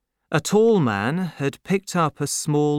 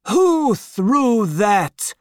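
much higher, slow, louder demand; affronted Marquis; 10 semitones higher, 5 dB louder, much slower than usual. A narrator's reading. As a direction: demand it with cold outrage. Marquis angered.

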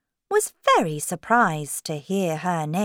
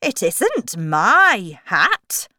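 slow, loud, swooping voice; Hatter; 2 semitones higher, 7 dB louder, slower than usual. Acted. Hatter answering the king, slow swooping voice. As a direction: deny it with a loud, swinging tune. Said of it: "the Hatter told the king".

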